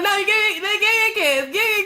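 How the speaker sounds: very high, insistent whine; exaggerated high-pitched begging; mock begging. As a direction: plead like a needy crybaby, hammed up. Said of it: "making the ugliest face".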